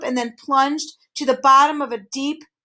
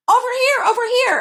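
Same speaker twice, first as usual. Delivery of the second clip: calling out; 14 semitones higher, 6 dB louder than usual. A narrator's reading. Hidden voice guiding the boys, calling out. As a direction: call them over from a distance. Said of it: "advised the voice".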